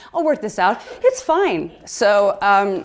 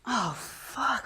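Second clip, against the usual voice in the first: muttered dismay; 4 semitones higher, 9 dB quieter than usual. Retold or imitated. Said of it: "I was like".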